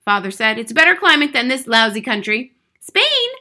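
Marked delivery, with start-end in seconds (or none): 0.6-2.5 loud, higher, impatient; brusque father. A narrator's reading. Father complaining in a hurry. 2.8-3.3 put-on high, loud disbelieving child; cried out. A narrator's reading. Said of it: "cried Matilda".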